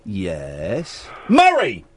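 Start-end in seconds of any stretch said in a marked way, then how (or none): 1.3-1.8 sudden eureka burst on a name; triumphant recall. Real, not acted. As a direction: blurt it out, higher and louder. Suddenly remembers the forgotten name.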